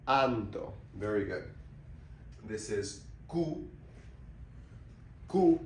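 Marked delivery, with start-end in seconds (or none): 5.3-5.6 "Ku" with a tight rounded u, lips nearly shut; closed-off ku. Shown as a demonstration. Teacher showing the closed u sound in "Ku". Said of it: "Nice and closed off".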